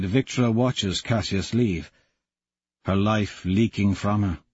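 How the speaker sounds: plain reading voice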